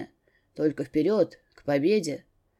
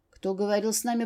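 ordinary delivery, nothing marked

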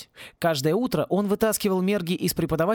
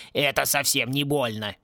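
a gruff mock echo of the girl; mocking mimicry; 3 semitones lower than usual. A narrator's reading. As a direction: parrot her words back in scorn. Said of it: "передразнил её Клинч". (передразнивая, с издёвкой)

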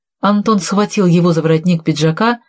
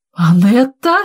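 mildly astonished cry of the name; amazed exclamation; 3 semitones higher, slower than usual. A narrator's reading. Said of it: "воскликнул Виталий, изумлённо глядя". (изумлённое восклицание)

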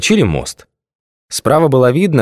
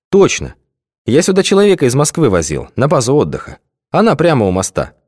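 higher voiced driver, confident; 6 semitones higher than usual. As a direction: answer sure of yourself. Driver character answers.

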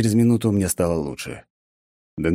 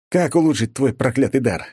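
higher exasperated demand; losing patience, pitched up; boiling over; 7 semitones higher, 3 dB louder than usual. A narrator's reading. Hero repeats to a teasing god, exasperated. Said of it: "Я начал закипать".

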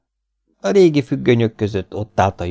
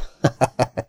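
eerie higher cackle; strange unnatural laugh; 6 semitones higher than usual. A narrator's reading. Masked stranger laughing at Stanley: strange unnatural laugh. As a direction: laugh oddly, unnervingly. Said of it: "furcsa, természetellenes hangon nevetett".

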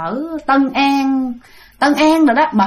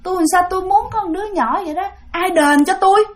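high, complaining demand for compensation; whiny ghost woman; 7 semitones higher than usual. Retold or imitated. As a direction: whine about who will pay her back. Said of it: "Bả, bả nói". (giọng bà ma nhõng nhẽo đòi đền)

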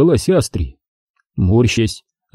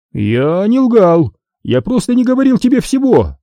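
defensive; 10 semitones higher, 3 dB louder than usual. A narrator's reading. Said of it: "оправдывался Диди".

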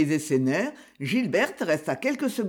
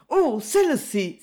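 a lightly voiced cry of delight; exclaiming; 7 semitones higher, 3 dB louder than usual. A narrator's reading. Gilberte spots the little green lamp in the case, exclaiming. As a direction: cry out at the sight of it, a touch higher. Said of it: "s'écria Gilberte".

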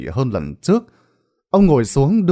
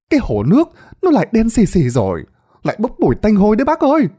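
high, rushed alarmed villager, fast; alarmed report; 9 semitones higher, 3 dB louder, faster than usual. Acted. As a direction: blurt out the bad news quickly. Villager reports the blackened pond. (giọng hốt hoảng, nói nhanh)

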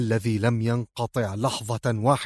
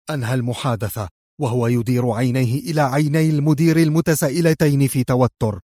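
ordinary delivery

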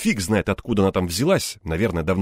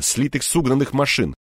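usual speaking voice

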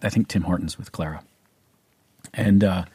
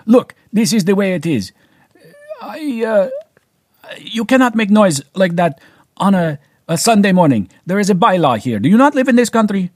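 high, loud mock Eastern European accent; hammed-up Eastern European neighbor scolding; terrible Eastern European accent; 11 semitones higher, 6 dB louder than usual. Imitating the Eastern European neighbor scolding him over noise, loud mock accent. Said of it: "That's a terrible accent".

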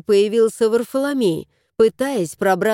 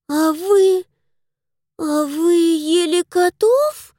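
put-on slow, higher, aghast child; horrified little girl; 7 semitones higher, slower than usual. A narrator's reading. Little girl horrified at the grown-up, slow. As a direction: gasp it out in childish horror. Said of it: "ужаснулась девочка".

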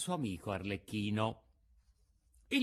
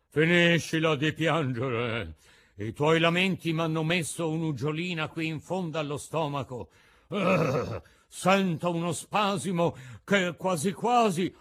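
gruff shout; 5 semitones higher, 7 dB louder than usual. A narrator's reading. Mangiafoco yells at Pinocchio to stop crying, loud gruff bellow. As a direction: bark at the crying puppet. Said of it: "gridò a Pinocchio".

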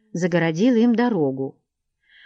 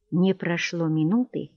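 ordinary delivery, nothing marked

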